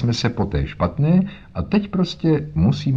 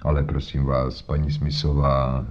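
low, soft reassurance; calm the worried woman, voice lowered; gentle, low dismissal of her fears; soothing Holmes; 5 semitones lower, 3 dB quieter than usual. A narrator's reading.

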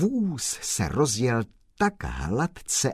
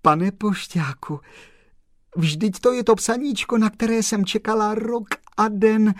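much higher, louder, overjoyed outpouring; tearful joy; 11 semitones higher, 6 dB louder than usual. Acted. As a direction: gush with tears of happiness. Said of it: "oči se jí zalily slzami radosti".

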